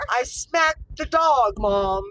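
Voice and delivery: put-on child proudly owning up, drawn out; slow loud proud kid; kid's proud answer. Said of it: "[name], what'd you just do".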